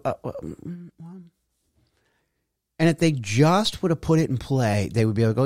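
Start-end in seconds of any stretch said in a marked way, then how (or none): 0.0-1.3 mock stutter. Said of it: "they'll stutter".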